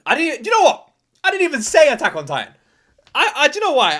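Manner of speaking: loud, high animated outburst; excited. Real, not acted. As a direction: blurt it out excitedly. Excited realization mid-reaction.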